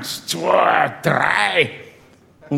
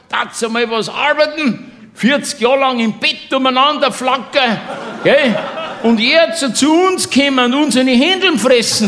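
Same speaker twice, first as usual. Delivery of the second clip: shouting across; 9 semitones higher than usual. Retold or imitated. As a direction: bawl them out from the next table. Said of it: "schreit dann rüber zu denen".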